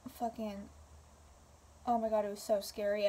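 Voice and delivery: plain reading voice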